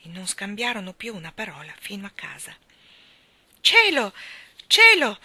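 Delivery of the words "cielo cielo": high, loud cry of amazement; excited exclamation. A narrator's reading. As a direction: burst out with astonished news. Mr Banks rushing home amazed.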